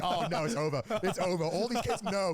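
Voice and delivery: hammed-up horror, climbing as it goes; mock panic. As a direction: play it as comic doom. Mock despair at kids' internet knowledge.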